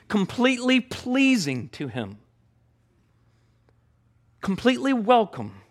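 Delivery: fervent preacher climax; rising fervor. Real, not acted. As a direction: build the wonder up and up.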